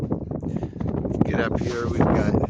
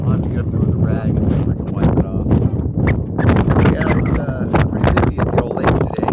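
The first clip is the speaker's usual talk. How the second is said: loud voice raised against the wind; shouting over wind; 9 dB louder than usual. Real, not acted. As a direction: shout so you're heard over the gusts. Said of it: "If you can even hear me over the wind".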